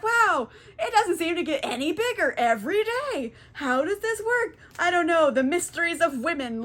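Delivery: mock-baffled, high-pitched dimwit voice; dumb suitors. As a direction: imitate clueless men marvelling. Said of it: "how fucking dumb are these suitors".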